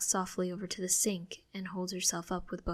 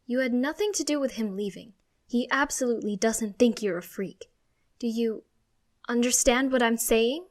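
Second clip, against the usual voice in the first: raised, insistent reassurance; emphatic reassurance; 4 semitones higher, 7 dB louder than usual. A narrator's reading. Mother convincing child it's not their fault, insistent. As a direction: press the point home firmly.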